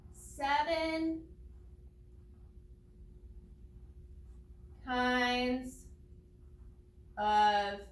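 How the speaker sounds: each word drawn out, long pauses between; slow, deliberate dictation voice. Shown as a demonstration. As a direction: say it slowly as you write each word. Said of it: "we're gonna write that down".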